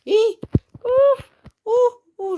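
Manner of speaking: very high, loud startled cries; panicked squeals. Real, not acted. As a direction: squeal and gasp in sudden panic. Sudden danger in game. (petits cris aigus de panique)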